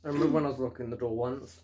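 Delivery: ordinary talk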